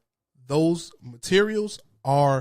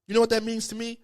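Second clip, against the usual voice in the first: normal talking voice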